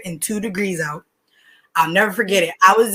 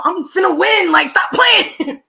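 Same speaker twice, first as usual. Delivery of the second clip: higher, slow, confident brag; cocky boasting; 7 semitones higher, 3 dB louder, slower than usual. Real, not acted. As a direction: boast like a sure winner. Boasting about winning.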